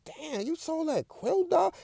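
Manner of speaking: high, soft nerdy squeak; a stunned nerd, piping up; Curl's voice. Retold or imitated. Curl, shocked she sold the quilt, squeaking. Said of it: "He like".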